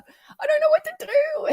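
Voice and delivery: very high, tearful mock wail; crying outburst. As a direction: explode into an acted helpless cry. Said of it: "You're gonna cry. You're gonna explode".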